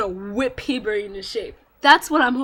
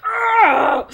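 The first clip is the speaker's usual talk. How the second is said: high loud shriek of joy; fangirl scream; 11 semitones higher, 9 dB louder than usual. Real, not acted. Fan seeing Colonello's curse released, joyful scream. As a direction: scream with delight. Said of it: "I'm like".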